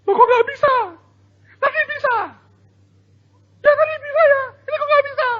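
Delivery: put-on very high, loud bewildered kid's cry; shocked child. Child failing to lift again, high loud dismay. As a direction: sound like a child who can't believe it failed.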